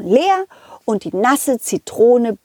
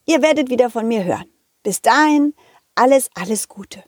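usual speaking voice

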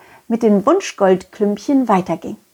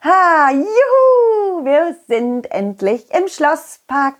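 joyful cheer; 6 semitones higher, slower than usual. Acted. Landing in castle park, joyful.

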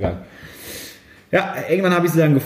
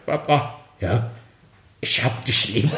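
put-on slow, lower slurred drunk voice; drunk sister; 5 semitones lower, slower than usual. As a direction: slur it like a drunk daughter. Drunk sister calling father.

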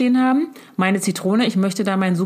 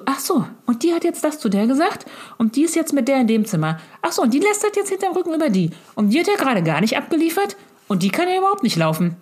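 gossiping commentary; 6 semitones higher than usual. Retold or imitated. Inner gossip commentary on show.

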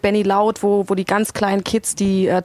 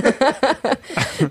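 lachen; 5 semitones higher than usual. Real, not acted. Laughs again.

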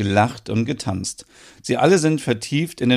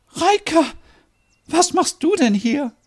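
very high, astonished voice; high-pitched, tearful astonishment; overwhelmed surprise. Acted. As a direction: greet an unexpected loved one in disbelief. Merit astonished, tears in eyes.

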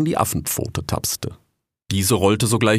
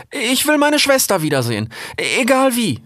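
high fox voice; 4 dB louder than usual. Acted. Young fox pleads desperately.

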